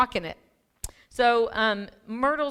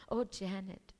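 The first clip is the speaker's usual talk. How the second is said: put-on Thai accent; 10 dB quieter than usual. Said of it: "he's from Thailand".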